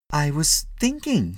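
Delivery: high, slow protest; defensive husband. A narrator's reading. Husband answering scolding wife, defensive. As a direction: defend yourself, stretching it out.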